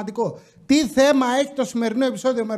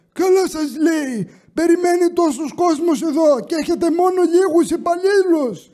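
imitating grandpa; 8 semitones higher than usual. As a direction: whine it like a grumpy grandpa. Grandpa joins the complaint.

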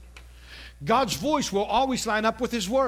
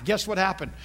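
normal talking voice

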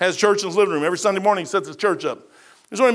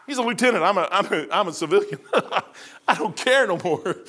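ordinary talk

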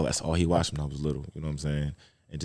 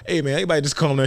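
fast, higher calm-down plea; mock peacemaker; 9 semitones higher, 5 dB louder, faster than usual. Father stepping into the room to calm wife and kid, fast. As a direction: rush to settle the fuss.

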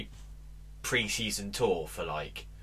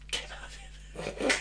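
slow, breathless whispered echo of the punchline; hushed repeat; 7 dB quieter, slower than usual. Shown as a demonstration. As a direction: breathe the punchline back slowly. Joke repeated as a hushed aside.

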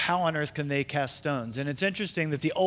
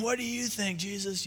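raised pitch, held-back volume; mock yell; 4 semitones higher, 9 dB quieter than usual. Crowd demanding an answer from Jesus, softly mock-yelled. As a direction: imitate a yelling crowd without the volume. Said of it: "they're yelling at him".

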